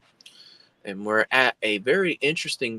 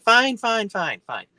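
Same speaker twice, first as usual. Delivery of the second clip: high, loud hurried surrender; exasperated giving in; 11 semitones higher, 6 dB louder than usual. Retold or imitated. Driver giving in to screaming friend, high exasperated cry. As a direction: act out flustered agreement.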